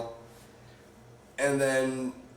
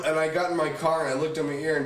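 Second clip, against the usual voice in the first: ordinary delivery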